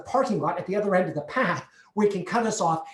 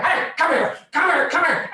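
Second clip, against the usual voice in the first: high, loud commands; insistent, angry hollering; ranger yelling; 11 semitones higher, 6 dB louder than usual. Retold or imitated. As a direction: shout after someone escaping. Ranger yelling after them again.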